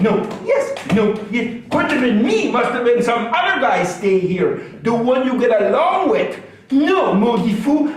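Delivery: put-on old Acadian storyteller, franglais, lively; Acadian character voice. Stand-up character telling the crowd about his wife, Acadian accent. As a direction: play Lucien grumbling about wife and doctor.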